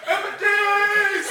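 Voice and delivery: loud, high, slow chant of "M and D's"; excited shouting. Retold or imitated. Kids shouting from the car, loud, slow and excited. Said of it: "we're shouting it out from the car".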